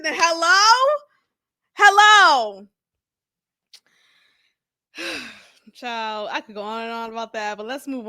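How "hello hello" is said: mock calling out.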